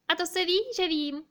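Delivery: high, loud storybook magpie; loud, high-voiced bird reply; cawing magpie. A narrator's reading. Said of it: "kráká stracha, straka".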